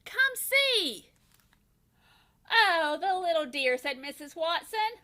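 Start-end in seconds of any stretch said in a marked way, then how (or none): 0.0-1.1 high, louder call; husband calling his wife to the door. A narrator's reading. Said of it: "he called". 2.5-3.8 higher, with a laugh in it; wife melting at the piglet; tender cooing. A narrator's reading.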